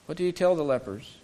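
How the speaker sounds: ordinary delivery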